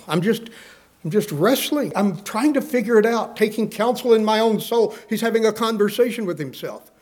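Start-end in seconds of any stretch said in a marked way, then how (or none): none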